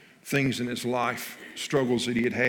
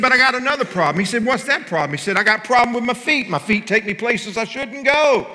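much higher and louder, fast blurting patient voice; excited patient; 11 semitones higher, 10 dB louder than usual. Acted. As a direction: spill out the confession, loud and high. Patient blurting another problem.

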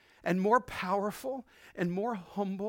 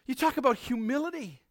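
ordinary talk

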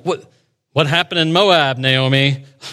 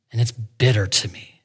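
quiet bitterness; 7 semitones lower, 6 dB quieter than usual. Retold or imitated. Voicing bitter Naomi's lament.

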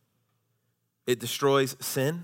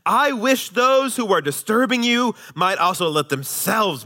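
raised, measured Bible reading voice; scripture reading; 6 semitones higher than usual. Acted. Pastor reads scripture to the congregation, measured and raised. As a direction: read the passage out to the church with weight.

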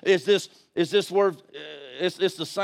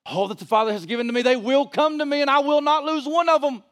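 rising, high preacher's proclamation; quick start, building to a ringing declaration; forceful scripture quoting; 9 semitones higher, 3 dB louder, faster than usual. Real, not acted. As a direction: proclaim it to the congregation.

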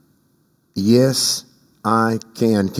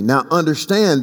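ordinary talk, nothing marked

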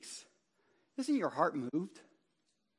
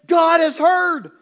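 slow, much louder and higher shout; triumphant shout; 12 semitones higher, 18 dB louder, slower than usual. Acted. Preacher voicing the psalmist, a slow triumphant shout. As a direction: cry it out in triumph. Psalmist's joyful outburst retold.

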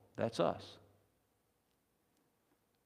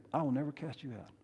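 soft, quick reassuring reply; hushed, steady promise; Jesus' reassurance; 11 dB quieter, faster than usual. Acted. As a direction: answer the fearful sinner quietly. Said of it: "The response of Jesus".

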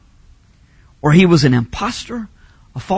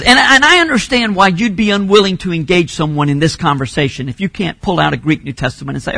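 usual speaking voice

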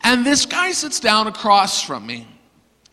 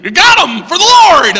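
high, loud rowdy shout; hammed-up celebration; mock triumphant cheer; 12 semitones higher, 9 dB louder than usual. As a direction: holler it like a winning team. Friends on the bus celebrate converts.

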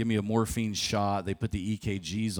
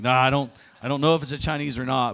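louder and higher, rushed and defensive; defensive protest; 5 semitones higher, 7 dB louder, faster than usual. Retold or imitated. Sick husband snapping back at his wife, loud and defensive. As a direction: brush off her blame, fast and loud.

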